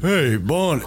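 hammed-up cartoon character calling out; character impression hail; Joe Swanson. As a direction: call out with a swooping cartoon voice. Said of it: "what about this character?".

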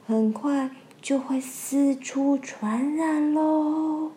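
slow, sneaky, drawn-out villain; sly lantern fish. Acted. Lantern fish spreading the rumour, slow sly voice. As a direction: savour the trick like a schemer.

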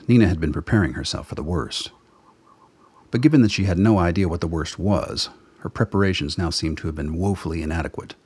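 ordinary talk, nothing marked

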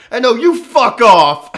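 very high, very loud, slow group shout; crowd yelling back. Retold or imitated. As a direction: bellow it back at the drunk guy. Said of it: "this whole side of the bar just turns and yells".

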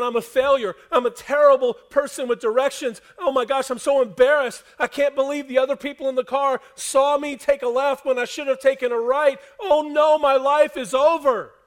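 mock a driver wailing that life is over; melodramatic despair. Preacher mocking a lost driver's overblown despair, hammed-up wail. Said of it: "getting out of the car and going".

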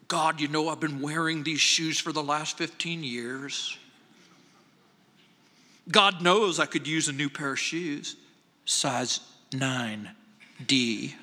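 low, understated plea for pity; hint heavily at your need, voice kept low; poor-mouthing pastor. Retold or imitated. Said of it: "what he called poor mouthing".

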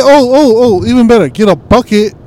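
high, loud burst of excitement over a bucket trap; blurting out the idea, all fired up; excited. Real, not acted. Friend pitching a bucket trap, excited and loud.

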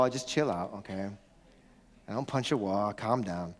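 low, soft reassurance; low, quiet backing off; calming down. Real, not acted. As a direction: drop the voice and settle everyone. Backs off the punching joke.

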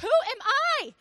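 high, loud disbelieving cry; incredulous Moses. Acted. Moses having his moment of doubt, incredulous. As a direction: throw up a protesting question.

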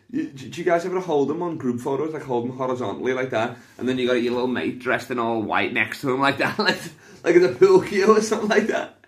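roast rising to a loud high finish; building roast. Acted.

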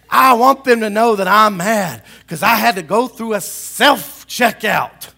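high, loud mock tirade; acted petty anger. Shopper mad about a self-checkout, ranting. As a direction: whine and yell in outrage. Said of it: "getting mad about things and freaking out".

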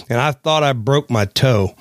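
ordinary talk, nothing marked

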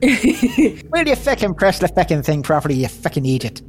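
lightly Irish, mock exasperated at a partner; a hint of an Irish brogue, scolding; Irish accent. In a touch of Irish, the dwarf scolds his clumsy companion. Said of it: "that's a really good accent though".

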